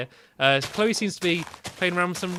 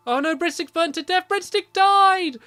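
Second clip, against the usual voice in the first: high wail building to a loud, drawn-out cry; dismayed outcry; 10 semitones higher, 5 dB louder than usual. Pretend. Gamer sees pet Breadstick burn to death, dismayed. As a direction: cry out in alarm, stretching the last word.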